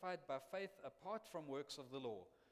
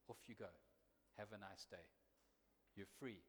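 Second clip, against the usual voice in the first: much softer, hushed release; whispered; 7 semitones lower, 8 dB quieter than usual. Retold or imitated. Policeman sending the forgiven sinner away, softly hushed. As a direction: tell them gently they are free. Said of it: "whispers it".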